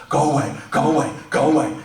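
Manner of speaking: loud, high, pained shouting; yelling go away. Retold or imitated. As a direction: push dad away with a yell. Hurt son yelling at father.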